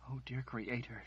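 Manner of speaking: a broken little prayer; crying out. Acted. Margaret cries out to the Creator, softly anguished. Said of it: "she cried".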